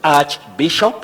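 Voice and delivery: "archbishop" said slowly with a clear ch, as in church; pronunciation demo. Shown as a demonstration. Teacher on TV modelling a word, slow demo. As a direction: spell out the arch sound in "archbishop". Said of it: "pronounced as arch".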